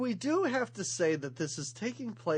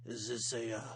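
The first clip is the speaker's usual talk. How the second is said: Snake impression; 7 semitones lower, 4 dB quieter than usual. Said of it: "Amazing snake imitation".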